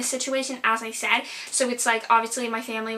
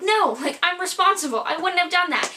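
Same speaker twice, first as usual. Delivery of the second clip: defensive retort; 7 semitones higher, 3 dB louder than usual. Retold or imitated. Acted defensive reply to grandmother.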